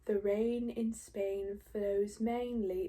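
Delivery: slow, crisp posh British English; slow posh British accent. Retold or imitated. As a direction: enunciate like a proper English lady. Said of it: "she sounds, all of a sudden, beautiful, crisp".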